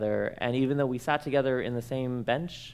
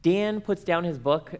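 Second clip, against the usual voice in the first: normal talking voice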